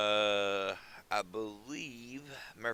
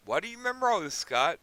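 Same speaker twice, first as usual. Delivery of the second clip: much higher and louder, a teasing voice questioning himself; mock interviewer voice; 9 semitones higher, 6 dB louder, faster than usual. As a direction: pop up as a nosy off-screen questioner. Asks himself as another voice.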